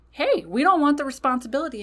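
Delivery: a shadow pestering the caster mid-spell, high and fast. Retold or imitated. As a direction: pop up and nag, high and quick. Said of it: "he pops up again".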